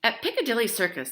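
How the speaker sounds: ordinary delivery, nothing marked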